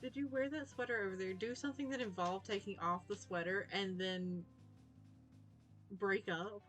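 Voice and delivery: ordinary talk, nothing marked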